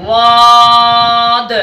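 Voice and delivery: واد held very long and clear; drawn-out letter name. Shown as a demonstration. Teacher demonstrating each letter slowly. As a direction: stretch واد like a slow recitation. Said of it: "ایسے ہی چاروں حروفوں کے اندر".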